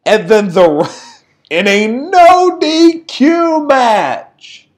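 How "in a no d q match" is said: high, drawn-out disbelief; incredulous. Real, not acted. Podcaster mocking a no-rules match decision, incredulous. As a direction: stretch it out in exasperated disbelief.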